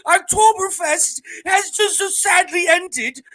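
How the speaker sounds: very high, loud, hammed-up German; mock German accent. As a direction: proclaim it slowly as a comic German caricature. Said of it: "What's a German accent?".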